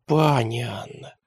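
soft, slow, fawning; ingratiating. A narrator's reading. Tremba sweetly addressing Lady Anna. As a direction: ease in sweetly. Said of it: "вкратчиво начал Тремба".

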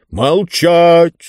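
high, loud, stretched-out yell; imperious tyrant's shout; Mister Speaker yelling. A narrator's reading. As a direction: bellow a command for silence. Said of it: "заорал мистер Спикер".

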